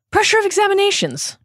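high, fast, loud exclamation; shouting. A narrator's reading. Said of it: "shouts the Tofster".

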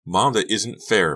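restrained narrator's version of a scream; screamed protest. A narrator's reading. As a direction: cry unfair at mom, drawn out. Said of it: "Becca screamed".

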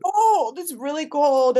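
high, loud exclamation of shock; retold cold shock. Newcomer reacting to Boston's weather, loud shocked yelp. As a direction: cry out as if hit by cold.

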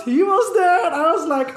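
usual speaking voice

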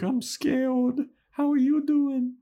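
put-on a tiny high scared-kid voice; squeaky Dawn. Podcaster voicing Dawn answering Willow, high and squeaky. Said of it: "Dawn's like".

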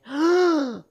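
a sudden loud gasp of alarm; loud, high shocked gasp; sharp panicked gasp. Retold or imitated. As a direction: suck the air in at once, alarmed. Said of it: "I immediately went".

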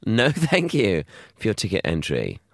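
ordinary talk, nothing marked

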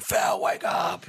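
slow drawn-out shouted wake-up; scream. Retold or imitated. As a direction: yell it to wake someone.

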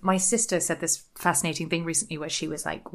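normal talking voice

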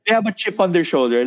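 ordinary talk

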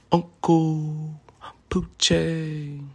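high, drawn-out name call; announcer voice. Acted. Host announces his name.

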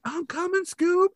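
very high cartoon panic voice; Shaggy. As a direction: squeal it like a frightened cartoon sidekick. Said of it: "Shaggy runs. He's like".

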